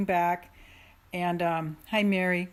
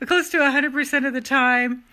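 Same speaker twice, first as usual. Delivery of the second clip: higher and louder, driving it home; loud emphatic; 7 semitones higher, 9 dB louder than usual. Real, not acted. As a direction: proclaim it with force. Repeats the phrase, shouted.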